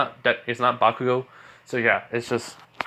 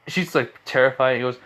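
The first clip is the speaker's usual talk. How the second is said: plain reading voice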